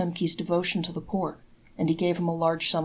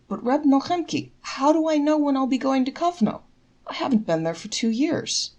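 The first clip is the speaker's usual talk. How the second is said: puzzled; 8 semitones higher, 4 dB louder than usual. Acted. Puzzled father objects.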